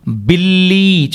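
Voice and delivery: బిల్లి slowly, doubled ల held; slow careful pronunciation. Shown as a demonstration. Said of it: "లాకి లా ఒత్తిచ్చాం". (బిల్లి నెమ్మదిగా ల ఒత్తుతో)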